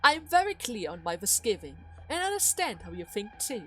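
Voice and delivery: low, restrained needling; cool taunting. Acted. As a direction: taunt with quiet, measured control. Duke coolly taunts his daughter.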